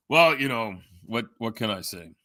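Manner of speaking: ordinary delivery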